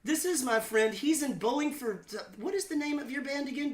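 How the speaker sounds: high-pitched, lightly mocking imitation of an actress; Sissy Spacek. Actress introducing a singer to her friend, high mock imitation. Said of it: "she said to her friend".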